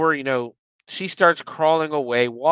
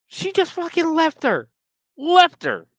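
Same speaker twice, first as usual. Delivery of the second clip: indignant outrage; 12 semitones higher than usual. Real, not acted. Still upset at the ending.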